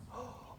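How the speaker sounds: sharp frightened gasp. Acted. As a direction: catch your breath in alarm. Girl hears bad news.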